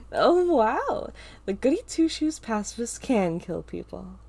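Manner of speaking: mocking taunt, loud on the first words. A narrator's reading. Ava taunts Star mockingly, loud sneering jeer. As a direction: sneer at the pacifist. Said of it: "Ava laughs at Star".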